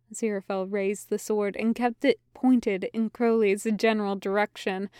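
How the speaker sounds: usual speaking voice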